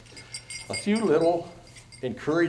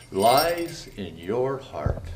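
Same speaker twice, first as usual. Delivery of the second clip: usual speaking voice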